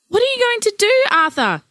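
high, loud distressed demand; wife desperately demanding her husband act; upset shout. Acted. Grandparents quarrel over lost child.

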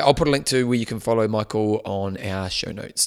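ordinary delivery

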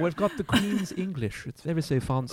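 slow, mock posh Queen's English; lightly mock posh English accent. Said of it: "forced English accent".

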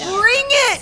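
high, loud cheer; excited. Real, not acted. Fan welcoming a trio reunion, excited. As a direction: cheer it on at the top of your pitch.

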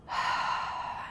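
long unvoiced in-breath; soft whispery breath in; audible breath. Shown as a demonstration. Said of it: "And breathe in".

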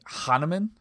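put-on tentative guttural German accent on Hahnemann. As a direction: try Hahnemann the German way, questioning. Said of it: "it's supposed to be more guttural".

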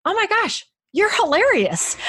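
high, delighted gush of praise; mock-gushing like an amazed husband. Husband to wife, raving that she's hilarious, high and gushing. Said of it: "he would be like".

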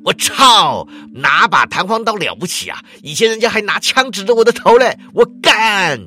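shouting curses while charging; angry cursing. Acted. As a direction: explode at the thug. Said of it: "骂道说".

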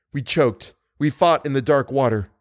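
ordinary delivery, nothing marked

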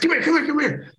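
模仿队友兴奋地招呼人过来; eager call. Retold or imitated. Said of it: "He said".

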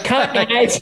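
high mock Chicago accent with a laugh; imitating sister's Chicago accent calling cut. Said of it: "her horrendous Chicago accent".

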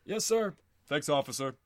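a polite nod to the officer; old man voice. Acted. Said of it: "says, uh, Sherman and Stevens".

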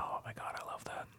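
very soft, nearly voiceless whisper; real under-the-breath aside; hushed delight. Real, not acted. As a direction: whisper it as a guilty confession.

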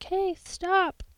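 put-on half-hearted mom calling a child to stop; mild parental warning. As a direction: imitate a mom's gentle, ineffective stop. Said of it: "probably a little bit more aggressive than that".

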